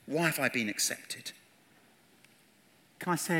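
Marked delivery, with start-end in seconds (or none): none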